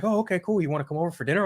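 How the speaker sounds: dad voice. Retold or imitated. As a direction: pitch it up to play dad. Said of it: "He's like".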